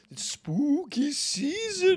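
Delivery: high, drawn-out mock-ghostly voice; spooky announcement. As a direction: stretch it out like a playful ghost.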